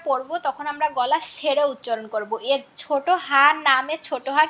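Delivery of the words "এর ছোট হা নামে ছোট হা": ordinary delivery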